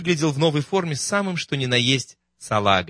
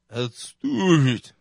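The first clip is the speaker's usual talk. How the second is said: басом; 3 semitones higher, much slower than usual. Acted. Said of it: "раздался тот же бас".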